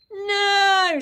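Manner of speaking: high, loud refusal; screamed no. A narrator's reading. Peter clutching his diary, screaming no. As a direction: shriek it, holding on tight. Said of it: "screamed Peter".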